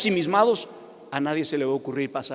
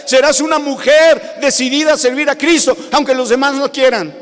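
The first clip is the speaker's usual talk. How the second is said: higher and louder, building to a shout; fiery preaching; 9 semitones higher, 9 dB louder than usual. Acted. Pastor promising the Spirit's power to the congregation, rousing preaching. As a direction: preach it hard, rousing the room.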